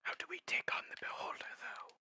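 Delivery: hushed secretive whisper. Acted. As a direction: whisper it like a conspirator. Whispering in-character voice.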